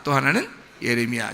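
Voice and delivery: ordinary talk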